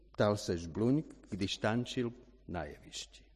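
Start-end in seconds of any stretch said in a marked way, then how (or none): none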